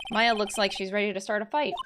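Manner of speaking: ordinary talk, nothing marked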